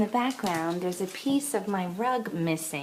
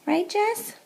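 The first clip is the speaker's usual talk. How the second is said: very high sing-song; talking to a dog; 12 semitones higher than usual. Real, not acted. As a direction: coo at the dog.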